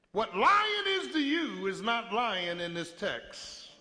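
ordinary talk, nothing marked

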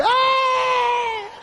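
sudden piercing high scream; high shriek. Retold or imitated. Big man finds the dead rat, screaming high. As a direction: let out a shrill startled scream. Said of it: "He, he running all down Chestnut Street".

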